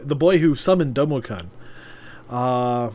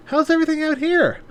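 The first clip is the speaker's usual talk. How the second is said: higher woman voice; 13 semitones higher, faster than usual. Acted. Yuna enters, voiced.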